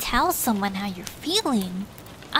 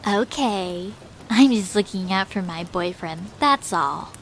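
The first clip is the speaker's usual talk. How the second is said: plain reading voice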